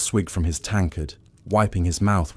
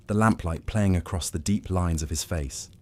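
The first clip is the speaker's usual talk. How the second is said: ordinary delivery, nothing marked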